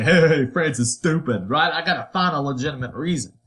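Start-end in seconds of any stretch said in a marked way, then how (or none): 0.0-1.5 mock taunt. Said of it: "I can't just go out and say".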